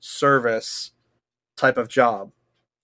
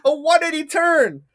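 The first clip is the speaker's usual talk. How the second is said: put-on amazed child; 12 semitones higher, 3 dB louder than usual. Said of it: "And you're like".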